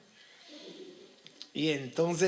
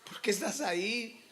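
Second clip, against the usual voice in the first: higher-pitched, repeated plaintive question; retold childlike plea, pitched up; pleading child; 6 semitones higher than usual. Speaker as a boy talks to Jesus crucified, childlike pleading. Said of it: "le iba a decir".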